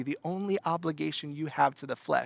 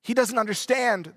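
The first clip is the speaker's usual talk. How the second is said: higher, wheedling small lizard voice; piping lizard; 7 semitones higher, 4 dB louder than usual. Acted. Lizard pleading with the ghost to spare it, higher wheedling voice. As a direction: coax him like a sly pet lizard. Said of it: "the lizard says".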